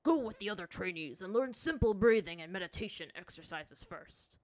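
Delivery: loud, firm instruction; stern master, dismissive. A narrator's reading. Zhang Zhang ordering Azula to train, stern. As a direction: send the pupil off sternly.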